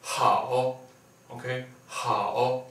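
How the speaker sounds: hao tief, fallend und wieder steigend; third tone. Shown as a demonstration. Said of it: "Third tone".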